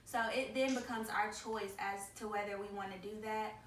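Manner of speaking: ordinary talk, nothing marked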